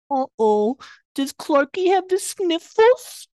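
high, slow mocking baby talk; teasing Lois. Lois teasing Clark about his cold in high baby talk. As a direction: coo it at him like he's a toddler. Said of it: "Lois is like".

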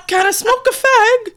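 very high, loud, hammed-up English caricature; annoying English accent. Friends pestering traveler with slang, imitated annoying English accent. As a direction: squeak it out in a terrible English accent. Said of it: "got an annoyingly English accent back".

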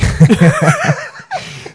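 loud, high burst of laughter; sudden loud laugh, way up high; big laugh. Real, not acted. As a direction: crack up at the punchline on yourself. Laughs at own joke.

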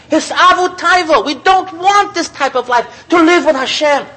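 high, slow, emphatic sermon voice; impassioned call to a new life with Hashem; fervent preaching. Real, not acted. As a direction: lean into each word with devotion. Preacher slows, rises in fervor.

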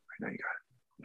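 whispered reassurance. Retold or imitated. To the sound guy mid-scene, whispered reassurance. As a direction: breathe it, no voice.

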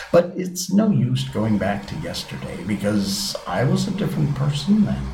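ordinary delivery, nothing marked

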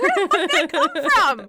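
high, loud, laughing question; laughing disbelief. Retold or imitated. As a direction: cackle it out in disbelief. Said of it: "I was like".

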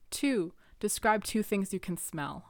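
ordinary delivery

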